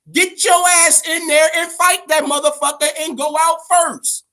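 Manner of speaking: mother on the phone scolding her son, shouting; scolding mom. Retold or imitated. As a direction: play an outraged mother yelling down the phone. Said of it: "gives him a call and says".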